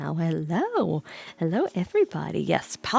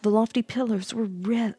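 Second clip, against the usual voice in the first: usual speaking voice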